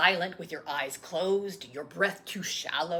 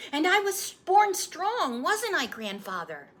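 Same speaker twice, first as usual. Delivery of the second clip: put-on high, eager child voice; a little boy pleading for the story; young boy; 12 semitones higher, 6 dB louder than usual. Boy asking his grandfather to retell his birth, eager child voice.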